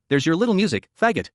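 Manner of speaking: normal talking voice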